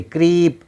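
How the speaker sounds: क्रीप with the ee stretched long; drawn-out vowel. Shown as a demonstration. Teacher showing learners how to stretch double e, drawn-out vowel. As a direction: hold the ee in क्रीप before closing on p. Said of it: "थोड़ा सा खींचेंगे".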